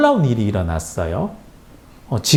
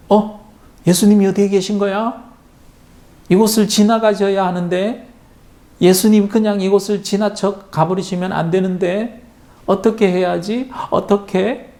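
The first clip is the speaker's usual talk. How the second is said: higher, fretful worried voice; anxious blind man; 7 semitones higher than usual. A narrator's reading. Blind Bartimaeus afraid Jesus passes by, higher anxious voice. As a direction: fret aloud, afraid he'll miss Him. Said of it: "그는 점점 마음이 급해졌어요".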